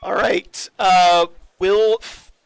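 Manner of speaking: accidentally booming into the mic; too loud. Real, not acted. Reading a viewer question to the hosts, too loud. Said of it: "sorry if I'm being too loud".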